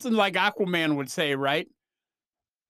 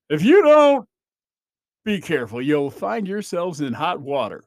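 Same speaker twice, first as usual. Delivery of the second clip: Aquaman hero voice; 6 dB louder than usual. Retold or imitated. Aquaman warning kids in a PSA, big hero voice. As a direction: lecture the kids like a cartoon hero. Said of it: "And in that voice".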